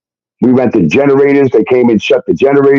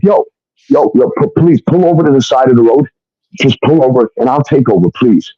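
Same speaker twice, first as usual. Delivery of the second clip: usual speaking voice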